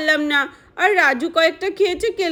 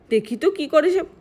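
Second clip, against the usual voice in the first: usual speaking voice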